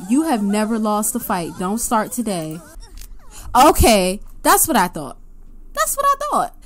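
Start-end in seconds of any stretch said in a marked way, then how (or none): 5.7-6.6 much higher, smug sing-song; mock sassy. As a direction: gloat like a sassy sim. Echoed in high mocking voice.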